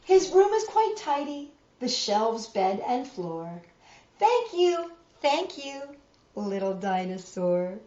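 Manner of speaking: storybook reading voice; reading to children. A narrator's reading. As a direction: read it like a bedtime picture book. Reads picture book to children.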